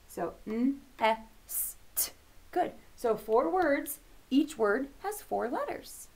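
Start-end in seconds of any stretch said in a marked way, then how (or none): 0.1-2.1 N, S, T as separate letter sounds; one sound at a time, with gaps. Shown as a demonstration. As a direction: hum the N, hiss the S, tap the T. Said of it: "we hear all of the sounds".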